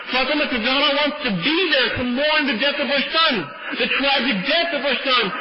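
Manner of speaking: high-pitched, grieving sermon delivery; impassioned lament. A narrator's reading. Preacher tells of a mother's grief, impassioned lament.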